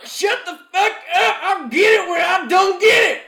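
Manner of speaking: high furious yelling; angry shout. Acted. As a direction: snap back at full volume, very high. Sketch, barber yells back angrily.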